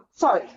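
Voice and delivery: choking cough from candy down the wrong pipe; real coughing after swallowing wrong; coughing fit. Real, not acted. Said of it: "Went down the wrong pipe".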